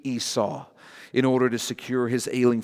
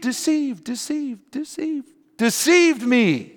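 deceived repeated, fading like an echo; echoing word; 11 semitones higher, slower than usual. Acted. Jacob's accusation echoing, fading out. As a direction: let deceived echo away.

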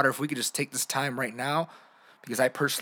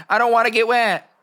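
high, loud comic whine; Kevin Hart; 7 semitones higher, 8 dB louder than usual. Acted. Adult begging kids not to soak them, high comic impression. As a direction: protest loudly in a squeaky comedian's voice. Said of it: "That's my Kevin Hart voice".

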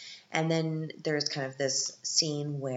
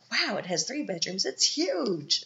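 higher and louder, hammed-up wonder; mock amazed; 7 semitones higher, 5 dB louder than usual. Small-town person marvels at three bedrooms, mock amazed. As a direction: gush over a small house like it's a mansion. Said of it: "you're like".

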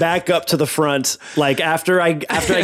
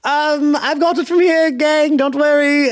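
much higher, put-on reassuring call; high call-back; 11 semitones higher than usual. Sneak telling the gang not to worry, high put-on voice.

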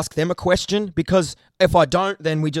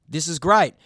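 ordinary delivery

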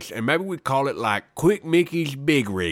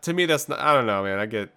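ordinary talk

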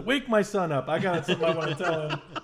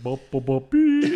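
imitated jingle notes; 3 semitones lower, 4 dB louder than usual. Shown as a demonstration. Host showing an obvious musical cue, sung-out mimicked notes. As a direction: voice a little tune, jumping up at the end. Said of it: "It's not like he went".

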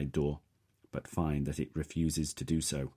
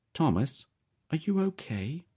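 worried mother; 12 semitones higher, 3 dB louder than usual. A narrator's reading. Mother answers son's call.

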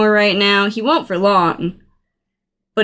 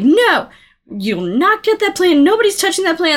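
defiant refusal; 8 semitones higher than usual. Retold or imitated. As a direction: refuse them outright. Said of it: "Seymour's like".

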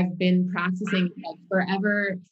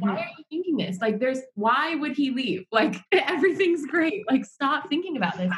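exasperated self-scolding; 7 semitones higher than usual. Retold or imitated. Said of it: "I was like".

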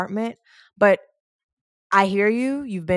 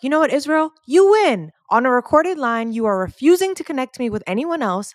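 higher and louder, fed-up exasperation; frustrated outburst; 5 semitones higher, 5 dB louder than usual. Retold or imitated. Caller finally snapping at unhelpful rep Israel, loud fed-up outburst. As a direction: blow up at him at last, fed up. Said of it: "I get so frustrated that I'm finally like".

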